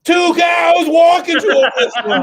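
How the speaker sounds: laughing shout. Acted. Comic yelling the opener to the room, laughing shout. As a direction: belt the opener out high and loud through a laugh.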